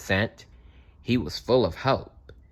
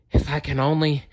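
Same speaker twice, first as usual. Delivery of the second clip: out of breath; 7 semitones higher than usual. A narrator's reading. Tiring Fox.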